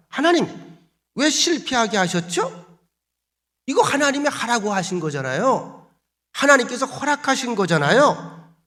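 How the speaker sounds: high-pitched aggrieved protest to God; petulant complaining. Acted. Joshua complains to God after defeat, petulant. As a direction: whine up at God in challenge. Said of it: "투정 부리는 말투지요".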